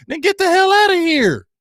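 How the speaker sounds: high, rising coach tirade that peaks in a shout; fed-up coach. Retold or imitated. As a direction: work up into a coach yelling at his roster. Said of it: "That's, that's the coach I want".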